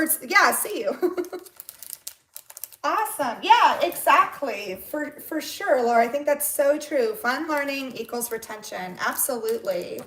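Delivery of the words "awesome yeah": normal talking voice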